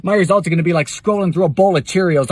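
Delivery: ordinary talk, nothing marked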